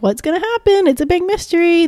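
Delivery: high, breathless mock-mystery voice; mock dramatic suspense. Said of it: "it's not really like WandaVision where it's like".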